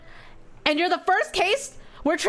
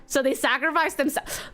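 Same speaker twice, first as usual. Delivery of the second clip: ordinary talk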